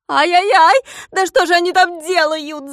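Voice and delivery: very high, loud shriek of rage; enraged shriek. A narrator's reading. Klops seeing his vegetables ruined, enraged shriek. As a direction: shriek as the garden is wrecked. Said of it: "закричал Клопс, наливаясь от злости".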